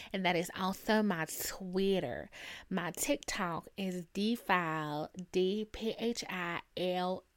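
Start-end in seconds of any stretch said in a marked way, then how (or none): none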